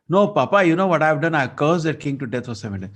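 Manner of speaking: put-on a boy boasting of his curse to Papa; excited boast. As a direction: proudly blurt it to Papa.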